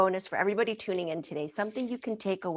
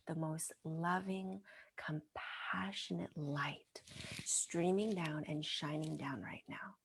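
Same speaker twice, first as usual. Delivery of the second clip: soft, slow whispered guidance; guided visualization; 8 dB quieter than usual. Retold or imitated. Healer whispers a guided visualization to a crying woman. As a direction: lull her into the light.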